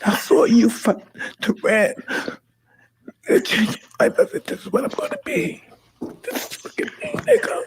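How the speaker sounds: high, broken pitch through tears; sobbing out the words, overwhelmed; tearful confession to his idol; crying. Real, not acted. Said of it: "That's the reason why I'm crying".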